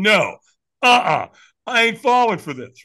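emphatic refusal. Retold or imitated. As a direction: refuse loudly, having none of it. Acting out his own refusal.

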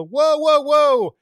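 high, loud repeated whoa; panicked rider screaming whoa at the horse; yelling whoa. Retold or imitated. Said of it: "I was yelling and screaming".

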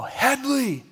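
high mock yell of his name; coach yelling name. Coach calling a player off the bench with a mock yell. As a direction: call out like a coach across the field. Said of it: "I hear him yell".

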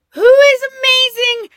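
high, loud squeal of admiration; loud high-pitched gush. Real, not acted. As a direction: squeal it out, thrilled by her. Gushing about an amazing woman.

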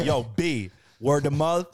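slow, drawn-out New York slang; New York accent. Acted. Host joining friend's New York bit, New York accent. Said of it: "That's New York".

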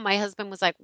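ordinary talk

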